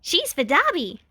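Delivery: high, bouncy and eager; gleeful girl cheering; high excited cheer; excited squeal. A narrator's reading. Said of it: "bounced and clapped her hands excitedly".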